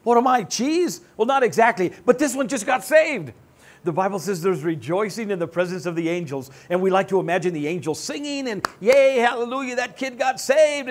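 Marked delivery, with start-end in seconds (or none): 0.0-3.3 higher, louder, offended whine; sulky believer asking if he is cheese; mock indignant complaint. 8.8-10.9 mock angel cheer.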